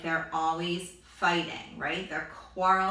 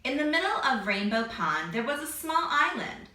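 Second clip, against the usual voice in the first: put-on reading to children. Reads story to children.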